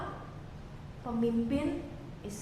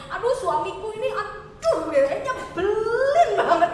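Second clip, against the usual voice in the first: higher, half-laughing gripe about her husband; mock exasperated wife; 5 semitones higher, 4 dB louder, slower than usual. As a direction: play an annoyed wife, laughing as she complains. (istri kesal mengeluh soal suami, sambil tertawa)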